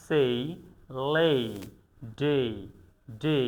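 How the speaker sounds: সেই, লে, ডে each alone, ending in "ey"; AY glide drill. Shown as a demonstration. Teacher drilling the AY sound, word by word. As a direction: model সেই, লে, ডে with a clear Y glide. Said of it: "এটাকে আমরা বলি এই".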